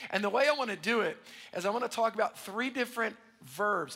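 ordinary talk, nothing marked

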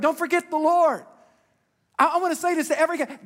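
loud, high and driving, hammer it home; preaching at full force. Acted. Pastor pleading with his congregation, loud and high.

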